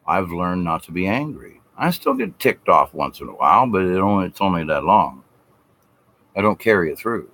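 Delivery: ordinary delivery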